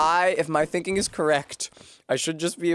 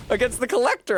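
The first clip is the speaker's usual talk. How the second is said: excited cheer; 7 semitones higher than usual. Real, not acted. Cheering after winning the fight.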